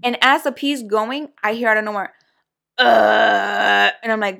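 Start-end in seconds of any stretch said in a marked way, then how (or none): 2.8-3.9 a drunk man's loud burp, mimicked; imitated burp. Mimicking the drunk caller's burp on the phone. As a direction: belch it out loud and gross.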